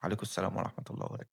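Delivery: a lazy father mumbling replies to passersby; bored greeting. Retold or imitated. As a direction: rattle it off fast, chin in hand, dead bored. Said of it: "حاطط إيده على خدّه كده يقول".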